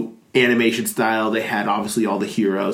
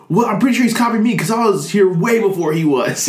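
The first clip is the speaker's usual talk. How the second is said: higher-pitched, indignant mock retort; Deathstroke; 9 semitones higher, 4 dB louder than usual. Deathstroke making his meta reference, mock indignant. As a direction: lightly act out a miffed comic character. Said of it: "he makes his meta reference. He's like".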